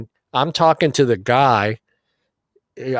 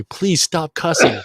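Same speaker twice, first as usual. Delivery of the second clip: slow, higher-pitched imitated plea; begging; 5 semitones higher, slower than usual. Casino lady to cussing winner, begging. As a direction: draw it out, pleading in mock desperation. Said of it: "she's begging me".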